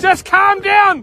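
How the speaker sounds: slow, drawn-out yell. Real, not acted. Angler to his frantic mates, yelling. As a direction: shout for calm, anything but calm. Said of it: "Why are you two yelling".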